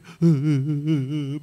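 loud scornful burst of laughter; a big mocking laugh before the whine. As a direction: laugh out loud at them in mockery. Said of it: "and Ram will say".

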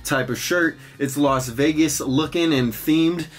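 outro promo. Acted. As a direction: pitch your shop to viewers.

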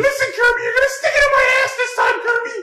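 very high, mock-girly; squeaky high girly voice. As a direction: squeak it out like a cartoon girl. Echoing the squeaky Kirby voice.

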